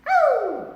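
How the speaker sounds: high loud whoop after the list; excited whoop. Real, not acted. Fitness vlogger pumping up viewers, loud excited whoop. Hyping up a workout video.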